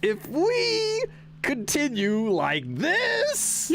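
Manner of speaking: very slow, high, drawn-out words; dramatic game character. Acted. Streamer voicing a game line, high and drawn-out. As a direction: wail it slowly and theatrically.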